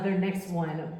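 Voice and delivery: ordinary talk, nothing marked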